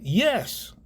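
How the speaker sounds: insistent single-word confirmation; emphatic. Real, not acted. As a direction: land it as a final, certain answer. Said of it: "the answer emphatically is a yes".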